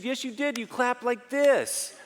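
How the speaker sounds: lightly voiced wife, accusing; wife's voice. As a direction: play the wife pressing her point. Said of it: "She said".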